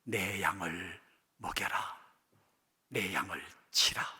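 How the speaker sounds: whispered, slow and tender; the Lord gently charging Peter, in a whisper; hushed whisper. Retold or imitated. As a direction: breathe the Lord's command softly. The Lord's words quoted.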